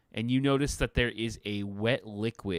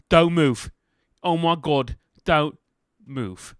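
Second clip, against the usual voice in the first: loud, sharp order to freeze; urgent warning; 5 semitones higher, 11 dB louder, slower than usual. Acted. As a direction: stop them dead before they touch. [name] warns the students.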